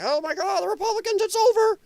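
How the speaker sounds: mock panic. Streamer mocking Democrats celebrating, rising high voice. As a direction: squeal it like giddy Democrats. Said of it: "you're trying to say".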